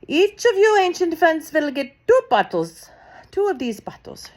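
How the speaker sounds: high, loud witch's shout to the crowd; Grand High Witch calls out to assembled witches. A narrator's reading. Grand High Witch shouts to the witches, high and loud. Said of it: "she shouted".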